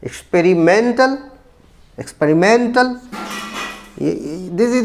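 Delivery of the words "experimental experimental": experimental said slowly with a long, rising MEN; stress on fourth syllable. Shown as a demonstration. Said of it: "this is stressed". (experimental के चौथे syllable men पर ज़ोर)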